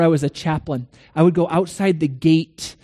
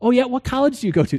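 eager questioning; 7 semitones higher, faster than usual. Retold or imitated. Acting his eager questioning.

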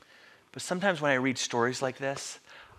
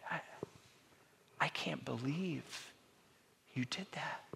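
sad disappointment; 15 dB quieter than usual. Acted. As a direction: barely voice it, let down by someone you love. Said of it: "eyes of just sadness and disappointment".

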